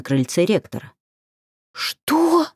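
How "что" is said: high astonished gasp; shocked high question. A narrator's reading. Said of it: "ахнула Алька".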